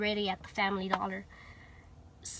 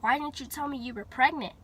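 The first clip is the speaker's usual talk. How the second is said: plain reading voice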